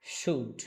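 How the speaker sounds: "শুড" as one syllable with a silent L; pronunciation model. Shown as a demonstration. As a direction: model "শুড" clearly, no L sound. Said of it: "উচ্চারণ করার try করেন আমার সাথে".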